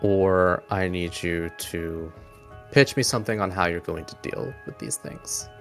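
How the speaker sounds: usual speaking voice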